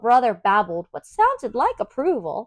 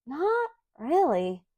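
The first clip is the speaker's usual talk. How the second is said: doubtful thought; 6 semitones higher, 3 dB quieter, slower than usual. Acted. Luna thinking to herself, doubtful.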